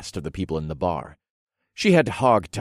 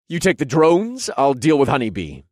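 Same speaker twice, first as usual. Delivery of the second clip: loud, raised combat command; urgent order; 6 semitones higher, 6 dB louder than usual. Acted. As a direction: call it out to your partner mid-fight. Hero barks orders mid-fight.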